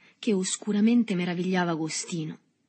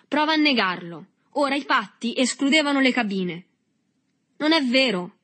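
raised, forceful argument; violent retort; 7 semitones higher, 6 dB louder than usual. A narrator's reading. Boys quarrel over a hiding place, loud and violent. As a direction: shout back just as hard. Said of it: "rispose Berto con uguale violenza".

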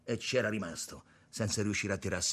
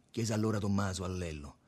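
ordinary delivery

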